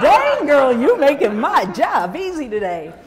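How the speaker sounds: tickled, loud Southern aside; amused Southern sass. Retold or imitated. Speaker amused at the woman, loud and laughing in a Southern accent. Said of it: "I'm thinking to myself".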